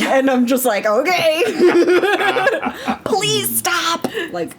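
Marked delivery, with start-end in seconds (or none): 0.9-4.1 high, laughing, unhinged plea. Retold or imitated. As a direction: squeak out a frantic please stop. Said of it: "unhinged neurodivergent woman".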